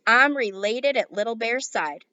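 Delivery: usual speaking voice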